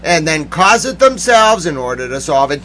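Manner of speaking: usual speaking voice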